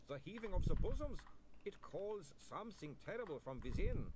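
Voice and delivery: high, slowed vampire voice in torment; a vampire begging a young lady to understand; anguished vampire. A narrator's reading. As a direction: confess it slowly, high and strained. Otto explaining his craving.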